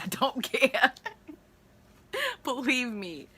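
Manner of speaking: real laugh through the words. Real, not acted. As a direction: laugh it off, defiant. Laughing defiance after attack rant.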